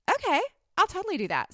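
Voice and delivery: a high, quick, bubbly okay; eager yes. Retold or imitated. Mom retelling how she agreed to go on TV, high and eager. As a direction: jump at the offer, all eager.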